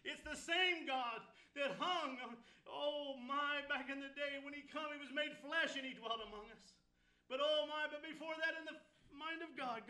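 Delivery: climbing pulpit intensity; full-force preaching. Acted. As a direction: lift the congregation with rising fervour.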